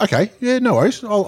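high, casual no-worries voice; breezy agreement. Retold or imitated. Donor agreeing to support them, breezy high voice. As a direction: toss it off like it's no big deal.

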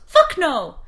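put-on loud, high refusal in a Dublin accent; mock Dublin accent, shouted; Dublin cabbie. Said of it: "First response".

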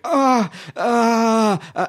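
high, strained groans, hammed up; cartoonish pained ahs; mock dying groans. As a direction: imitate a stabbed game character moaning. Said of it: "roll around on the floor going".

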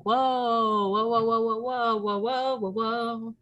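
stretched high whoas, calming someone down; a string of alarmed whoa, whoa; group trying to defuse a fight; retold whoa chorus. Everyone reacting in alarm.

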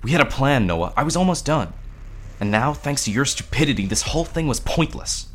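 exasperated, heated reproach; bitter argument. A narrator's reading. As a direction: argue back and lay blame. Parker argues back, blaming Noah.

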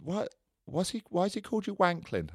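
put-on very high, small child's voice; high-pitched childlike questioning; little girl. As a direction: imitate a puzzled seven-year-old daughter. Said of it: "my little girl then says".

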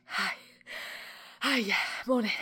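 loud, forced-cheery hello to hide panting; faking breezy greeting. Retold or imitated. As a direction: pretend you're not out of breath. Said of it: "you're trying to be like".

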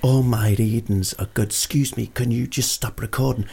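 ordinary talk

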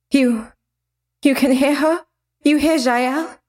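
high, halting disbelief; desperate stammer. Acted. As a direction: stumble over the words desperately. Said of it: "she stammered with a desperate tone".

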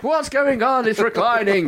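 put-on high, startled old man voice. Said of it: "My granddad's like".

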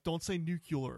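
nuclear said as "nucular"; wrong nuclear. Shown as a demonstration. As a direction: show nuclear wrongly as NOO-kyuh-ler. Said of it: "I know how not to pronounce it".

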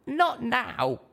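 high, plain little mare voice; a small pony chatting; high little pony voice, chuckling aside; young mare. A narrator's reading.

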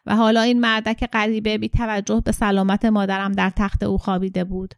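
usual speaking voice

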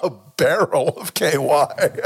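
chuckling through the words, slowly; amused slow laughing correction. Real, not acted. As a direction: laugh while correcting the phrase. Correcting phrase amid laughter.

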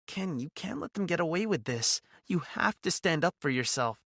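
lightly voiced firm, caring friend; grounding woman's voice. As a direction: urge him gently but firmly. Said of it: "her voice the grounding I needed".

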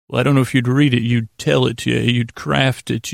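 ordinary talk

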